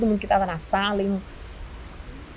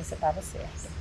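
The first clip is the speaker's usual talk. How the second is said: quiet confirmation; 4 semitones lower, 11 dB quieter than usual. Retold or imitated. Husband quietly confirming the diagnosis.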